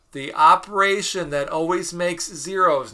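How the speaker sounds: loud, emphatic announcing; write-this-down voice. Shown as a demonstration. Teacher telling students what to write down, write-this-down voice. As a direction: proclaim it as a key note to copy. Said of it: "I'm using my write this down voice".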